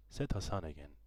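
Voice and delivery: plain reading voice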